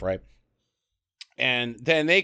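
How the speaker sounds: normal talking voice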